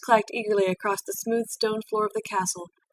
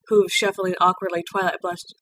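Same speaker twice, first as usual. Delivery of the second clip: ordinary delivery, nothing marked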